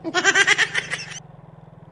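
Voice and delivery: a high burst of laughter; loud laugh. Real, not acted. Vlogger laughing at his sea-voyage joke, high laugh. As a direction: crack up, pitched high and loud.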